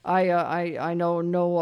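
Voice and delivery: usual speaking voice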